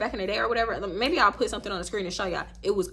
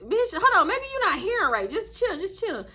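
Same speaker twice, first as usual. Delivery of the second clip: mock sassy comeback; 9 semitones higher than usual. Retold snapping back.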